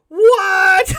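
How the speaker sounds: very high, loud shocked shout; stunned what. Retold or imitated. Firefighters shocked to hear Batman, very high and loud. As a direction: shriek it in disbelief.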